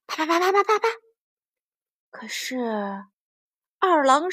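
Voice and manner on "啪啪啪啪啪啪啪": rapid, high, light pattering; high patter of blows. A narrator's reading. Storyteller imitating the fighters' blows, high light patter.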